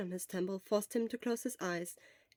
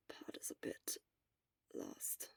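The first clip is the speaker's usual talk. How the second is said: weak whisper; barely a breath, worn out; whispered from a sickbed; 14 dB quieter than usual. Acted. Sick Shura whispers throughout.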